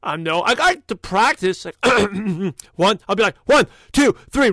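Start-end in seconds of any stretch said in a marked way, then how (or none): 3.5-4.5 louder, higher count to wake the voice up; raised voice. Shown as a demonstration. Warming up before a late-night call, loud raised count. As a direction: push the voice up and out on each number. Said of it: "raise my voice".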